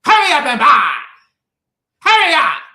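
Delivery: high, loud hammed-up shopkeeper hurrying a customer; impatient shopkeeper. Preacher imitating the Chinese store clerk rushing him, loud mock yell. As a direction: snap it like an impatient store owner. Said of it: "He go".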